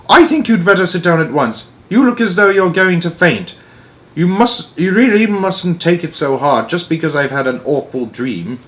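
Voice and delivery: a mildly sharp Queen telling her to sit; a touch sharp. Acted. Queen orders the faint maid to sit, mildly sharp high voice. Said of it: "rather sharply".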